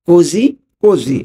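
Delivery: "Così così" drawn out very slowly, high; slow teaching repeat. Shown as a demonstration. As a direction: stretch every syllable of "Così così" for learners. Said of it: "তাহলে এটার Italian কি হবে".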